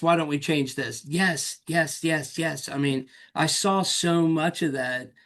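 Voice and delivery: usual speaking voice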